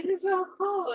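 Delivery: high-pitched mimicked delight; excited kids. Kids marvelling at the little animals, excited. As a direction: play a thrilled little kid. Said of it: "like, all excited".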